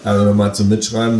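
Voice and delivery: plain reading voice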